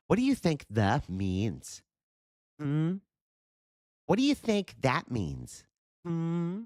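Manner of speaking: very high, slow, drawn-out mock questioning; cartoonish high condescending teacher; mock condescending quizzer. Streamer parodying the debater's pop quiz, very high.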